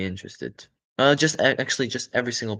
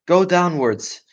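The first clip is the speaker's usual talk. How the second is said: higher, loud command; father fox commanding the family; ordered; 7 semitones higher, 8 dB louder, slower than usual. Acted. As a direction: bark the order to dig. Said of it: "ordered Mister Fox".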